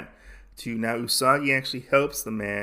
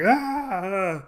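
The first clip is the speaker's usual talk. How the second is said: high drawn-out "ah" at normal volume; a mock yell, pitched well up; comic fleeing yelp; mimicked cry of alarm; 8 semitones higher than usual. Mimics man running away screaming.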